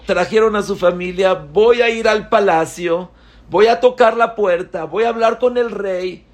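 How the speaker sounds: put-on high, loud, fast boyish excitement; bursting with a new idea; eager plan. Young Rabtubia excitedly planning to go to the palace, high and loud. Boy eagerly plans to see king.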